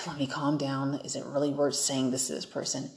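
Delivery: soft, low, hushed words; murmured calming reminder to yourself; calming self-talk. Shown as a demonstration. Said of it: "just think about it".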